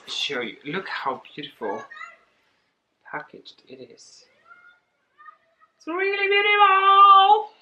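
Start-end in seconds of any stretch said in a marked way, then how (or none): 5.8-7.5 high, loud gush; gushing delight. Real, not acted. Showing the package to viewers, high gushing delight. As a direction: swoon over the packaging.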